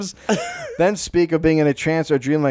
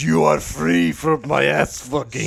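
ghost voice; slower than usual. Acted. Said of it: "Papa Bawa goes".

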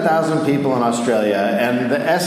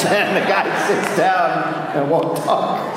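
normal talking voice

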